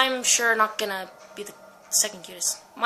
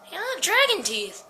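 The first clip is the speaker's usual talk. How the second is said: ordinary talk, nothing marked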